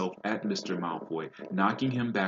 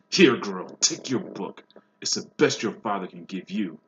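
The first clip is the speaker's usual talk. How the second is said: malicious taunt; 8 dB louder than usual. A narrator's reading. Lucius spits malice at Ginny.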